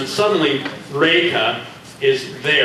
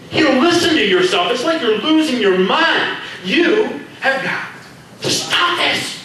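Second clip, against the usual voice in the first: much higher, urgent and louder; high alarmed Reika; 12 semitones higher, 4 dB louder than usual. Acted. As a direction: plead that he's losing his mind.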